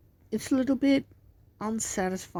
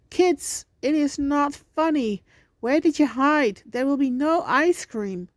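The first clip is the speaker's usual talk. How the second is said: high, loud frantic calling; panicked shouting; 6 semitones higher, 5 dB louder than usual. Acted. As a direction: yell for the hiding kids. Mom calls kids, panicking.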